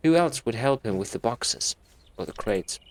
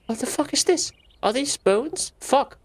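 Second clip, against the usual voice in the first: much higher, startled swearing; shocked Anne; 13 semitones higher, 3 dB louder than usual. Acted. Anne finding the bones, high and startled. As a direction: recoil at the bones.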